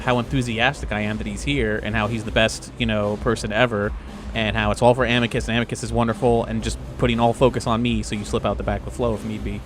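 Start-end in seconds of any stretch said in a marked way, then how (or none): none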